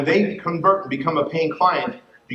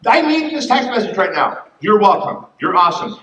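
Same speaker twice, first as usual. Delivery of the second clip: higher, louder rush of gratitude; moved client; 8 semitones higher, 4 dB louder than usual. Retold or imitated. Client answers the coach's text, higher and gushing. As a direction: gush like she really needed this. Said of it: "She goes".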